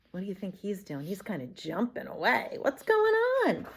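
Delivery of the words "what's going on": usual speaking voice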